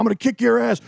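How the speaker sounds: high, strained mock threat; mock angry boyfriend. Storyteller mimicking angry boyfriend threatening him, high and strained. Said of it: "And he's".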